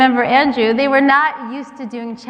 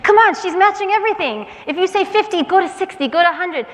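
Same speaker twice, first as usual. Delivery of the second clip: higher, eager egging on; student urging a neighbor to bid higher; excited urging; 8 semitones higher, faster than usual. Retold or imitated. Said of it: "the person next to them said".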